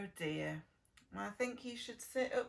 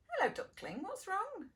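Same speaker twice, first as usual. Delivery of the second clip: pitched up, tender asking; high gentle voice; 10 semitones higher than usual. Acted. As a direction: soothe the worried duckling. Speaking to puppet duckling.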